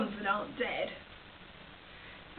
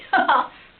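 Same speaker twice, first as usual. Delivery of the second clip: sudden loud burst of laughter; short, explosive laugh of delight; 4 semitones higher, 13 dB louder than usual. Acted. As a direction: burst out in one big laugh. Amused by grandmother's death by peanuts.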